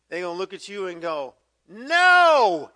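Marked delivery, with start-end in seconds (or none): 1.7-2.7 loud, high, drawn-out NO; defiant shout. Acted. Child refusing a parent, defiant shout. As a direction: yell a stretched-out refusal.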